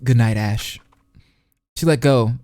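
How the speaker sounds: normal talking voice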